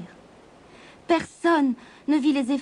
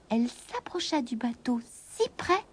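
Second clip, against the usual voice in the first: ordinary delivery, nothing marked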